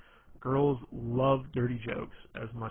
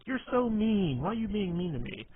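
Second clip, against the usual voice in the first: imitating a whiny girl; 8 semitones higher than usual. A girl he teases calls him mean, in a high mock whine. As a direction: squeak it out like a girl who's been teased.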